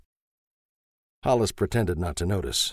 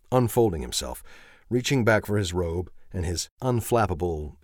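ordinary delivery

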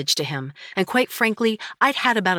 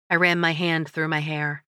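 usual speaking voice

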